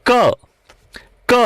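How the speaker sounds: "ક" as a short kuh, repeated after a pause; phonics consonant sound. Shown as a demonstration. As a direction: model "ક" alone, not the letter name see. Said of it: "phonics ઉચ્ચાર તમારે યાદ". ("ક"નો ટૂંકો, છૂટો phonics ઉચ્ચાર)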